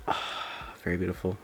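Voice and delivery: quiet awed praise; hushed admiration. Real, not acted. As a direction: murmur it in satisfaction. Satisfied after the peel.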